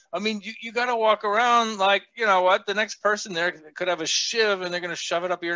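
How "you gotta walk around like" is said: ordinary talk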